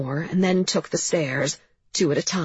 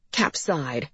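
ordinary delivery

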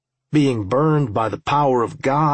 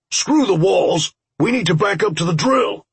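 raised, higher-pitched urgent command; urgent battle order; 7 semitones higher, 4 dB louder than usual. Acted. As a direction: call it out to the team under attack.

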